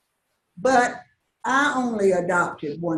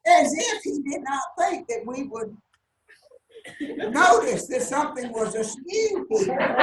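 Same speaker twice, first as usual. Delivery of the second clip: laughing; 5 semitones higher than usual. Real, not acted. Telling funny monkey story, laughing.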